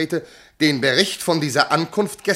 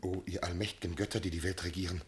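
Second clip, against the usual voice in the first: hushed, broken cry to the gods, fading into a whisper; overwhelmed man appeals to the gods, barely audible; stunned dismay; 7 semitones lower, 13 dB quieter, faster than usual. Acted. Appalled husband calls on the gods, hushed and shaken. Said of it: "Warum ergreift Bestürzung ihn".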